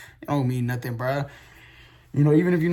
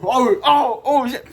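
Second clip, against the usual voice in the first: sudden realisation; 8 semitones higher, 6 dB louder, slower than usual. Real, not acted. Math suddenly clicks mid-problem.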